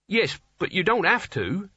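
high, anxious protest; nervous Nigel. A narrator's reading. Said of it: "said Nigel".

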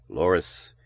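said softly, low and gentle. A narrator's reading. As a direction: murmur her name tenderly. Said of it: "he said softly".

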